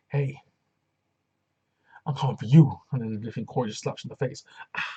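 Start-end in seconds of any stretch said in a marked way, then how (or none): 0.1-2.7 called out loud, like a taunt; wrestler's promo taunt shouted; mock challenge. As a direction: throw the threat at her. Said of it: "And she goes".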